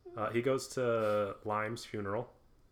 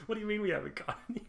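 very fast, higher disbelief; laughing friend questions the claim; incredulous; 12 semitones higher, much faster than usual. Real, not acted. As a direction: fire it back in amused disbelief.

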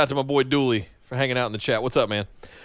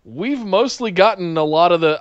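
ordinary talk, nothing marked

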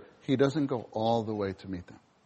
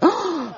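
shocked gasp. Retold or imitated. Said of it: "I would have said".

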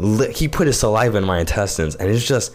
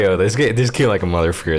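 usual speaking voice

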